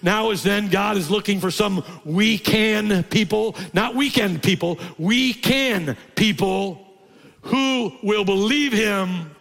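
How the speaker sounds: high, loud, slow preaching voice; full-force preaching. Acted. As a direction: preach it out to the congregation.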